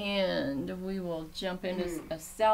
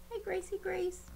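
much higher pet-talk coo; high cooing to cat; 13 semitones higher, 3 dB quieter than usual. Real, not acted. Owner coos up high at her cat Gracie.

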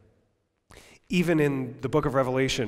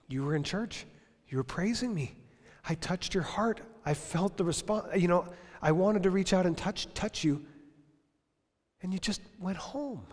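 higher, softer, grieving plea; voice of Jesus; 6 semitones higher, 3 dB quieter than usual. Acted. Jesus addressing a churchgoer who went home, higher and hurt. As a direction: speak as a hurt friend. Voicing Jesus speaking to listener.

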